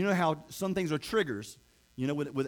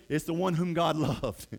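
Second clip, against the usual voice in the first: ordinary talk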